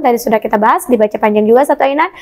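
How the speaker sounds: ordinary delivery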